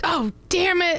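very high, squeaky yelp of pain; bug voice. Pretend. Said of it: "said something in his normal voice".

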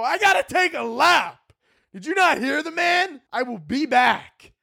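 high, slow petulant whine; whiny kid. Retold or imitated. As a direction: complain like a scolded teenager. Whiny answer to mom.

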